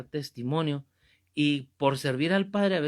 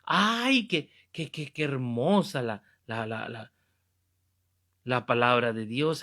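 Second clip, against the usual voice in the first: mock gushing; 3 semitones lower than usual. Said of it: "No se contente solo con oír, con decir".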